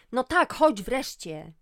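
loud, slow impatient groan; groan, hurry up already. Acted. Daughter groans impatiently at mother. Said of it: "Iga stęknęła".

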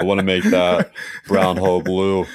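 low mock caller voice; deep, mock-sinister phone voice; creepy caller voice. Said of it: "the voice I hear in my phone calls".

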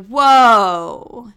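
loud, drawn-out whoa; taken aback. Retold or imitated. Girlfriend hearing her ex refuse the breakup, taken aback. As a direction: react out loud to an outrageous refusal.

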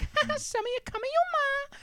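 high, fast, soft cutesy voice; mocking waifu video girl, squeaky and fast. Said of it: "they're like".

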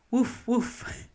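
high, loud playful woof woof; storyteller's loud mock dog bark. A narrator's reading. Big hungry dog arrives.

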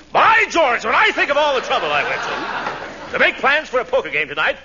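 blustering rant. Acted. Restarts his rant much louder.

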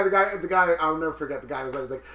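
ordinary talk

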